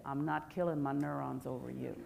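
inner thought. Pretend. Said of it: "think in your head".

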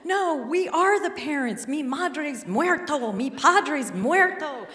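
high-pitched, urgent protest; husband's frantic pleading. Retold or imitated. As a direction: plead high, building to a loud last cry. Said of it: "my husband quickly says".

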